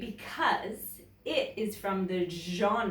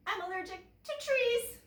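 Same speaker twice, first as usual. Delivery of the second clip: high surprised realisation; surprised polar bear; 13 semitones higher than usual. Acted. Polar bear discovering his tree allergy, surprised and high. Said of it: "he said with surprise".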